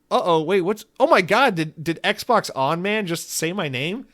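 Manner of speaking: high, startled gasp. Pretend. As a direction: act shocked at hearing your name. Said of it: "She's like".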